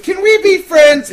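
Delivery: put-on high, loud, slow child squeal; little kid voice. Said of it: "follows it up with".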